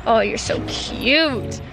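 ordinary talk, nothing marked

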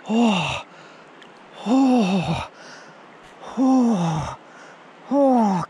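repeated cold shock gasps; shaky gasps of cold; in ice cold water. Real, not acted.

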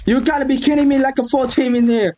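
very high, frantic gamer yelling; shrieky, indignant protest at being ambushed; outraged alarm. Real, not acted. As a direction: shoo the attackers off in a high-pitched panic. Full enemy team attacks in game.

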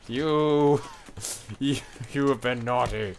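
loud mock villain scolding; booming echoing villain monologue. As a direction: boom it down like a tyrant. Mock god scolding player.

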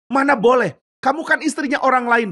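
high, indignant protest; startled, high-pitched objection; shocked refusal. Acted. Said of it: "Yusuf kaget".

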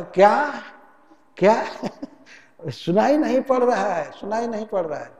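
high, loud "क्या" then a laughing claim that he hears nothing; feigned not hearing. Retold or imitated. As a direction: play deaf with a sly laugh. Debtor feigning deafness again.

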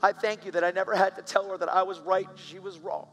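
prayer building from hushed thanks to high, loud praise; fervent prayer. Retold or imitated. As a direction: pour it out to God, swelling. Retold prayer rising to fervour.

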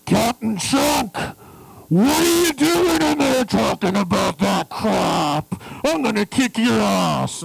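loud, angry shout over the speaker; thundering yell from above; booming voice of God. Retold or imitated. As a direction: bellow it from the control room. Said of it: "it's like the voice of God".

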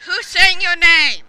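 loud, drawn-out question; calling out. Real, not acted. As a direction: call it out loudly to a friend online. Calling out to friend online.

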